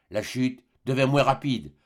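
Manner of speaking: plain reading voice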